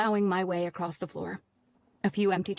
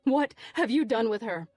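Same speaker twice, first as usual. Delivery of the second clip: frantic yell; 4 semitones higher than usual. A narrator's reading. Narrator demanding to know where Eve is, yelling. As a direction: press him hard. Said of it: "I yelled at him".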